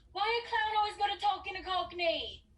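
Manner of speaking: high, loud irritated complaint; fed-up maid scolding the clown; exasperated. Acted. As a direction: vent your annoyance. Annoyed complaint after the repetitions.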